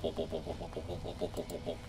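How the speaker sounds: warp repeated fast and quiet, like an engine hum; rapid warp chant. Shown as a demonstration. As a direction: chant warp over and over like a running engine core. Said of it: "if you say warp, but, like, multiple warps".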